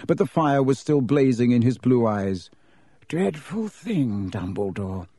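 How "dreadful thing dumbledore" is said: lazy drawl. A narrator's reading. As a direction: draw it out lazily, softening at the end. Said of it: "said Malfoy lazily".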